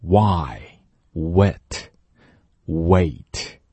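Why, wet, wait very slow, lips pouted then gliding; 慢速示范w音; slow w demo. Shown as a demonstration. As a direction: drag out each w glide. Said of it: "我把嘴撅起来，然后马上滑到".

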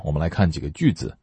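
ordinary talk, nothing marked

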